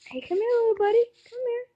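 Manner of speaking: high coaxing call to a little buddy; coaxing pet voice. Pretend. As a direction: call the chicken over sweetly, high up. Coaxing a chicken in game.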